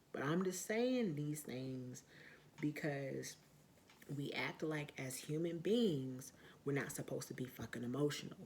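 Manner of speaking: ordinary delivery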